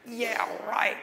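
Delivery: derisive laughing jeer; scornful, drawn-out disbelief; mocking scoff. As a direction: sneer it through a laugh. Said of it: "They reviled him, mocked him".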